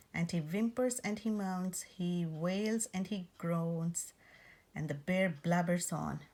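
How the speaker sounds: ordinary delivery, nothing marked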